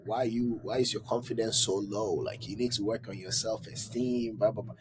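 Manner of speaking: ordinary talk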